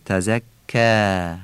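"تزکا" very slow, ending on a stretched alef; long final vowel. Shown as a demonstration.

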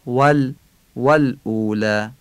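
ول and والاولی starting with a rounded "w"; clear Arabic waw. Shown as a demonstration. Teacher demonstrating waw in والاولی, clear Arabic waw.

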